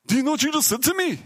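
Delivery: high, fast, outraged demand; mock-outraged Norwegian, fast and high; angry disbelief. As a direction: rattle it off, furious at the insult. Angry Norwegian, veins popping.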